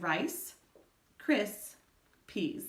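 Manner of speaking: rice, cris, peas said as three separate beats with pauses; syllables broken apart. Shown as a demonstration. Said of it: "break that word down".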